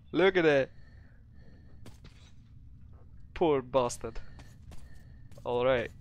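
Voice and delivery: put-on Russian-accented English. Gamer narrating his play in a put-on Russian accent. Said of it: "hard for me to do it Russian accent".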